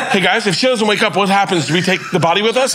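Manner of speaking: high, panicked questioning; mock panic. Dad checks with the medical team before his daughter goes under, acted panic. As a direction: ask in a rising hammed-up panic.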